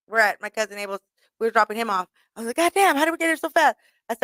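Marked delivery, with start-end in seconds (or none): 2.6-3.8 put-on drunken surprise. Drunk, arriving suddenly.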